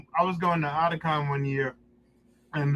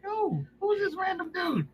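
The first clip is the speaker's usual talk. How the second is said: high puzzled question; 12 semitones higher, 4 dB quieter than usual. Retold or imitated. Puzzled in elevator.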